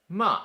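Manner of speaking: "ma" falling sharply from high; fourth tone. Shown as a demonstration. Teacher demonstrating ma for scold, sharp falling tone. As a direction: slice "ma" downwards. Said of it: "let's do them all together".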